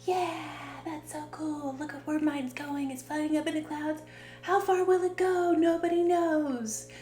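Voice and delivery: put-on high childlike wonder, rising in volume at the end; schoolkids watching balloons. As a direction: imitate a child marvelling at a balloon. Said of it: "we would all get a balloon".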